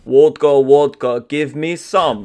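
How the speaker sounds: loud, slow mock Russian chant; Russian accent. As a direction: bellow it like a mock Russian drinker. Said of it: "accent heavy episode".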